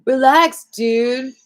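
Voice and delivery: high loud careless "Relax, dude."; dismissive relax dude. Retold or imitated. Host imitating a dismissive friend, loud and careless. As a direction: toss it off like you don't care. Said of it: "like I'm saying it like".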